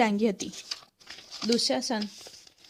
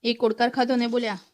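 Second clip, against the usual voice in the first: ordinary delivery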